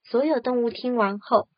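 ordinary delivery, nothing marked

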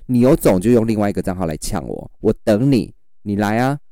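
ordinary talk

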